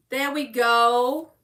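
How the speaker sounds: slow, stretched GO with a lift at the end; drawn-out announcing. Real, not acted. Reader showing the pulled card, drawn-out announcing. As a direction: say it out loud, stretching GO.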